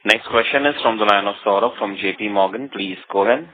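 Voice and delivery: normal talking voice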